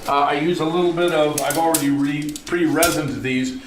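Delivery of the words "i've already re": normal talking voice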